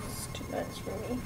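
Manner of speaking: breathy mock-overwhelmed whisper. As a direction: whisper it like a secret.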